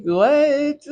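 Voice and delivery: a high, loud mock disbelief; stunned what. Hearing a classmate's slur, a shocked exclamation. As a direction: blurt it out in shock. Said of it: "So I'm like".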